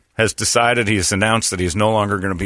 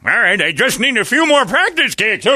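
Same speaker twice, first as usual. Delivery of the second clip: newsman voice; 13 semitones higher, 5 dB louder than usual. Retold or imitated. Newsman answering crew.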